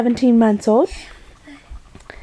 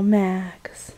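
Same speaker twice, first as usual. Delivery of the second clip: whisper; 6 semitones lower, 6 dB quieter than usual. Real, not acted. Whispering the cat's name.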